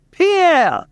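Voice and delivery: high, drawn-out farmer's holler; acted yell. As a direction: call out to a mule like a Cajun farmer. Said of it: "he yells out".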